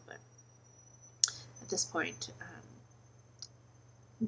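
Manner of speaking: teary, soft voice close to tears; after crying. Real, not acted. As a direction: speak gently, still shaky from crying. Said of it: "Sorry about the teary".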